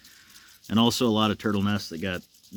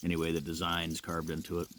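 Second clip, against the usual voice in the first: ordinary talk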